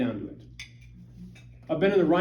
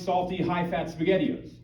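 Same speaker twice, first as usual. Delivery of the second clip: usual speaking voice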